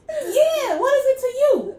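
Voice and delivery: very high, loud sassy comeback; imitated defiant grandma. Grandma defending her driveway nap, mock indignant. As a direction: throw it back indignantly, hammed-up grandma voice.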